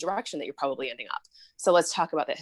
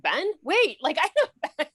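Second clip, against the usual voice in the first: much higher, laughing surprise; surprised recognition; 13 semitones higher, 4 dB louder than usual. Real, not acted. Host recognizing her own doctor in the viral video, laughing surprise. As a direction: realize with delight that you know him.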